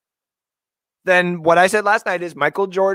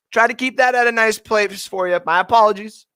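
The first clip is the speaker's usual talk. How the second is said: ordinary delivery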